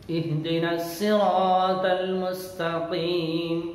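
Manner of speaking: slow, melodic tajweed chant; measured, sustained recitation on a steady note; Quran recitation. Shown as a demonstration. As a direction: chant it with long, drawn-out vowels. Quran recitation for pupils to repeat.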